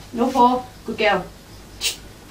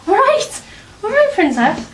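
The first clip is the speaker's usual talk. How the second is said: squeaky high sing-song to the dog; high cooing hello to a jumping dog; excited pet greeting; 10 semitones higher than usual. Real, not acted. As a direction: greet her like a delighted owner, much higher.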